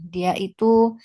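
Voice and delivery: ordinary talk, nothing marked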